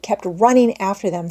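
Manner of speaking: plain reading voice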